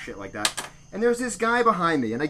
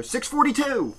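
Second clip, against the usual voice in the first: raised pitch, deli counter call-out; calling out a number; 7 semitones higher than usual. Retold or imitated. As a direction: sing out the order number over the queue. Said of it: "the deli starts calling out a number".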